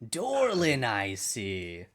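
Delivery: very slow, drawn-out spirit voice; sword spirit. Acted. Cursed sword greeting its new owner, slow spirit voice. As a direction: drag the words out as a talking sword. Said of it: "You hear a voice in your head".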